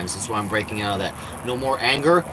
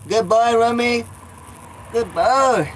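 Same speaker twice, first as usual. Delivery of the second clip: pet praise; 10 semitones higher, 4 dB louder, slower than usual. Real, not acted. Owner praising his cat Rummy in high cooing baby talk.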